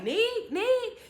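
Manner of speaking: high, loud worried calling; imitating a frantic mom; anxious mom call. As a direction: call out sharply, alarmed.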